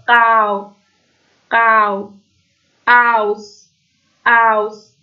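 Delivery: «قاو» and «آوس» slowly, each with a gliding "ow"; "au" diphthong. Shown as a demonstration. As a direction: model the "au" in «قاو» and «آوس», word by word. («قاو» و «آوس» شمرده با صدای آو)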